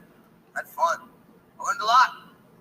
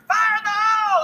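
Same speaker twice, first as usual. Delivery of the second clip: high, loud battle yell; a Marine yells a warning before test firing; soldier's shout; 8 dB louder than usual. Retold or imitated. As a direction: bellow the warning across the chopper. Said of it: "you just hear".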